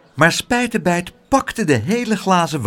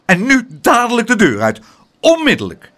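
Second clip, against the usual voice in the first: high, loud furious command; angry shout; 8 semitones higher, 6 dB louder than usual. A narrator's reading. Bitten mother throwing the child out, angry shout. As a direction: order them out at full voice. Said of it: "riep ze".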